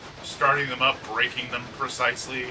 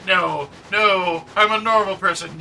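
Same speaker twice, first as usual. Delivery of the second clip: high, loud pleading denial; mock protesting self; 7 semitones higher, 6 dB louder than usual. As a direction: resist the evil voice, hammed-up. Arguing with inner Goblin.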